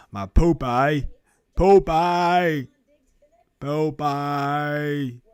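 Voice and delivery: a quick callback to the Forrest Gump voice; hint of Forrest Gump calling his friend. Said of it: "I had a whole Forrest Gump impersonation".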